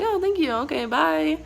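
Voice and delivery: high, sugary sarcastic thank-you; mock sweet thanks. Sarcastic thanks to the pushy guide at the airport. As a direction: chirp it brightly and fake. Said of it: "And I'm like".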